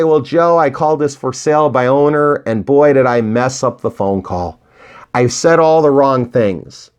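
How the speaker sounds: ordinary delivery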